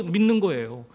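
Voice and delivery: ordinary talk, nothing marked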